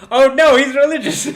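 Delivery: loud, high, laughing exclamation; laughing outburst. Real, not acted. Player breaks character, loud laughing exclamation. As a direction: burst out laughing mid-line.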